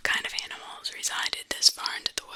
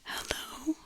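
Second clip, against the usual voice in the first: nervous call; 6 dB quieter than usual. Retold or imitated. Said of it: "Nervously, I called out".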